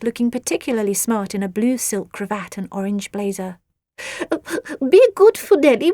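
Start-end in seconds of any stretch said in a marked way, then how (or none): 4.9-5.9 high, loud fussy beetle mother; a doting beetle mother, pitched up. A narrator's reading. Agar's voice in the story.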